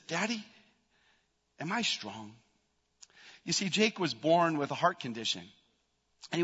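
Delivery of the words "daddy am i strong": very soft imitation of a little boy asking; seven-year-old boy. Son asking his father if he is strong, softly imitated. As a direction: quote a small son's hesitant question, hushed. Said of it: "looks up to me as a seven-year-old boy".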